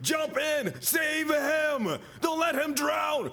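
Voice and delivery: frantic shout. Retold or imitated. Mother at the pool yelling at the lifesaver, frantic shout. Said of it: "the mother shouted deliriously".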